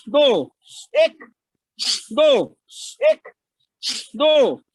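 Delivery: loud breath count. Real, not acted. Yoga teacher counting strokes for the class, loud rhythmic call. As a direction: call each number out like a coach. Coach counting second bhastrika round.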